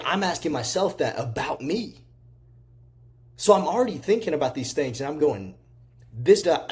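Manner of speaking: pumped, high-energy self-help rant; fired-up motivational preaching. Real, not acted. Preaching at full force.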